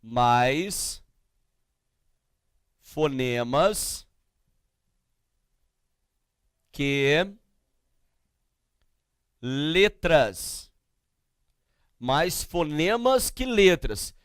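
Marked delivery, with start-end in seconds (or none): 0.0-10.7 word by word with long pauses, lower pitch; slow dictation. Real, not acted. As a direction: dictate slowly as if writing each word. Dictates slowly while writing. (ditado pausado, palavra por palavra)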